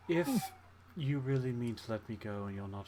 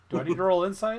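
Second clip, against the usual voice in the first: loud joking question; 7 semitones higher, 10 dB louder than usual. Acted. Joking aloud about an insight roll.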